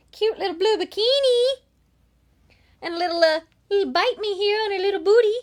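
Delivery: put-on high cooing baby-talk voice; cutesy gushing. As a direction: coo over it like a cute puppy. Gushing over cute wrestler photo.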